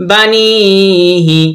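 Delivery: "বানিহি" slow with a long "নি"; drawn-out vowel. Shown as a demonstration. Teacher recites the spelled word, long "নি" in "বানিহি". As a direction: hold "নি" in "বানিহি", then short "হি". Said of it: "এখানে একটু টেনে পড়বো".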